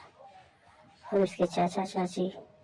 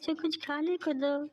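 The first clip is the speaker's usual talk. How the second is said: put-on high little boy asking for food; high, small boy's voice; child voice; 9 semitones higher than usual. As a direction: sound like a small hungry child. Narrator voices child Bhola.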